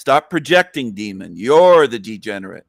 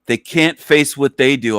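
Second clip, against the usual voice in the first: ordinary talk, nothing marked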